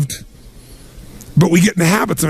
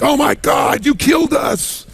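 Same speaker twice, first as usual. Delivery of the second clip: mock panic; 9 semitones higher, slower than usual. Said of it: "the people are like".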